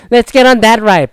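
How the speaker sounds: put-on high, loud kid pleading for a ride; mock kid begging; imitating an eager kid. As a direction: beg like an excited child. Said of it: "or like".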